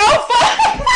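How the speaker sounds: very high, loud, laughing exclamation; scandalised shock. Retold or imitated. Retelling a reaction to a lewd podcast quip, scandalised. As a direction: shriek it in delighted mock shock.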